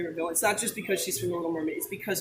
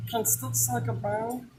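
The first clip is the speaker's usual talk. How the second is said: Stitch voice; 3 semitones higher than usual. Pretend. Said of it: "your Stitch is really bad".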